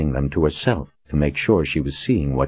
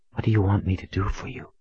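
whispered; 4 semitones higher, 5 dB quieter, faster than usual. A narrator's reading. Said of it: "she whispered".

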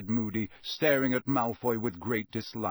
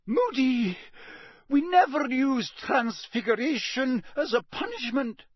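high-pitched, flustered protest; dismayed high voice; weak protest; 12 semitones higher, 4 dB louder than usual. Acted. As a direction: object helplessly in a high voice. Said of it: "said Professor McGonagall weakly".

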